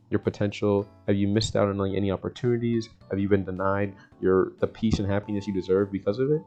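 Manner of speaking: ordinary talk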